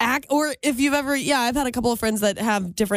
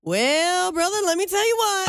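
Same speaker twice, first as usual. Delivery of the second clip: mimicked catchphrase; 7 semitones higher than usual. Woman catching herself talking like her co-host, drawled imitation. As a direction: echo a co-host's folksy catchphrase, higher pitched. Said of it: "what you say and what I say".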